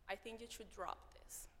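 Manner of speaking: hushed, dismissive whisper; whispered discouragement. Retold or imitated. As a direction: whisper it like a cold verdict. Quoting a discouraging person.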